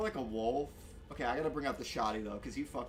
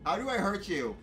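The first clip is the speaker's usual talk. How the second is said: higher, louder desperate shout; exasperated shout; 8 semitones higher, 7 dB louder than usual. Real, not acted. Gamer pleading with the wolf, exasperated shout. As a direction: yell it out, desperate. Frustrated at the dog.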